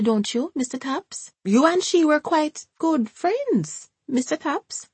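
put-on high, fluttery elderly woman's voice; bustling old woman covering up; old Jamaican woman. A narrator's reading. Hortense voiced.

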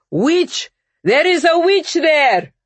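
high, loud denouncing cry; shout. A narrator's reading. Bear rousing the crowd against Vasya, shouting. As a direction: raise the alarm at full pitch. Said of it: "shouted the bear in the voice".

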